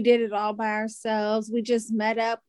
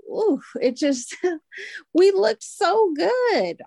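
high, slow and louder, thrilled; gushing; 8 semitones higher, 3 dB louder, slower than usual. Real, not acted. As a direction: stretch it out in delight. Gushing about their photoshoot.

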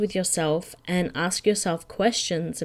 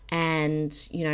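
ordinary delivery

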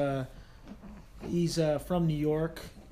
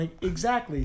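ordinary talk

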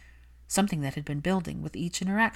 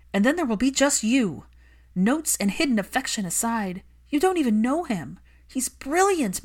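higher, louder furious plea; angry Hermione; 5 semitones higher, 5 dB louder than usual. Acted. Hermione scolding Malfoy for neglecting his son, heated. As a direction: defend the lonely child fiercely. Hermione voiced, tearful anger.

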